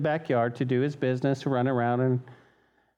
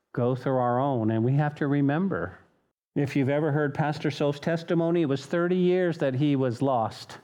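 ordinary delivery

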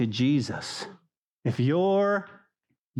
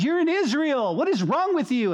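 God scolding; 11 semitones higher than usual. Retold or imitated. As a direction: snap him out of it with a sharp scold. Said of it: "slapped me upside the head spiritually and goes".

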